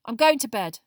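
short, loud, curt announcement; curt Harry. A narrator's reading. Boy ending the talk with his friends, curt. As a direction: cut the talk off curtly. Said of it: "said Harry shortly".

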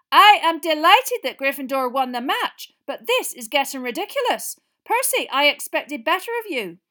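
high, loud, strict rebuke; a furious teacher restraining herself; stern professor. Acted. McGonagall furious at the noise.

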